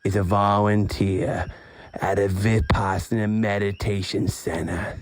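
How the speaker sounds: slow, measured reading aloud; book blurb read. Acted. Host reads friend's back cover to listeners, slow blurb read. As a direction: read it out slowly, phrase by phrase.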